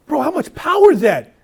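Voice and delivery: yelling in amazement at the other inmates; amazed outburst. Retold or imitated. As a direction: shout it out in disbelief. Amazed rant about the inmates.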